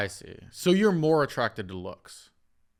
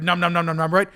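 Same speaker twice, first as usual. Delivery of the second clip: greedy nom noises; 5 semitones higher, 9 dB louder, faster than usual. Acted. Mimicking eating greedily.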